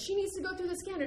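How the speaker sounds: high-pitched mock yell; retold yelling. Security guy objecting as she bypasses the line, mock yell. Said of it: "the guy yells at me".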